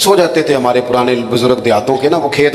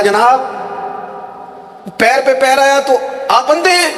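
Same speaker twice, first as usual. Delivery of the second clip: put-on much higher, offended old man's voice; indignant challenge; 10 semitones higher than usual. Old villager scolding the ruler for stepping on his foot, high indignant challenge. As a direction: challenge him, high and aggrieved. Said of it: "کہا".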